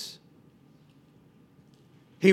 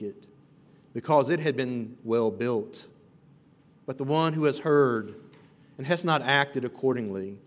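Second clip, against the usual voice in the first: calm, measured Bible reading, softer than the preaching; scripture reading; 5 semitones lower, 3 dB quieter than usual. Acted. Reads the scripture passage aloud.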